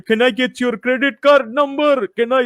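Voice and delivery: high-pitched mock scam-caller voice; put-on call-centre scammer voice. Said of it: "they're fucking calling you".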